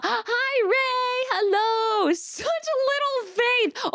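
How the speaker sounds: high, squeaky hello to a friend; light up as she walks in; excited greeting. Real, not acted. Streamer greeting her friend arriving in chat, excited.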